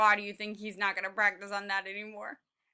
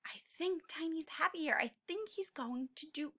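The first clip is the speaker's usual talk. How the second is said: soft unvoiced whisper; 4 semitones higher, 10 dB quieter than usual. Real, not acted. Teacher whispering to the class about Tiny. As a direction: hush it like a secret aside.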